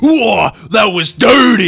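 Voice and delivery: high, loud delighted yell; excited shout. Real, not acted. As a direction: cry out at the cheap shot. GM reacts to dirty hit.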